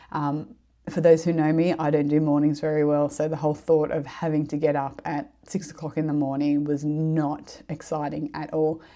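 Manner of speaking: ordinary talk, nothing marked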